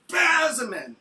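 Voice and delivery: high, loud, stretched-out yell; hammed-up convulsive shriek; mock spasming outburst. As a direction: burst out as if seized by a fit. Acts out her spasming fit.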